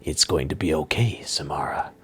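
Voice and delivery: soft, low soothing whisper; calm her like a frightened child; soft reassuring whisper to the captive. Acted. Uthrin calms the terrified girl.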